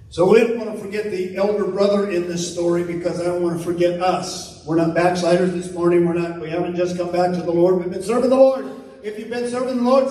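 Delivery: ordinary talk, nothing marked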